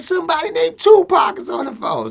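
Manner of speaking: high, loud child imitation; little daughter. Seven-year-old telling daddy about a caller, high imitated child voice. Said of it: "Like that".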